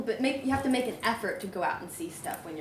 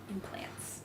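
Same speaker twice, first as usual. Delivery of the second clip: whisper; 12 dB quieter than usual. Real, not acted. Whispered confession.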